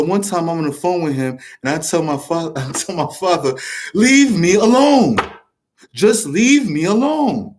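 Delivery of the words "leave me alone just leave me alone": slow, drawn-out mock plea with swooping pitch; exasperated plea. Son begging his father on the phone, exasperated plea. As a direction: stretch every word, raised and exasperated.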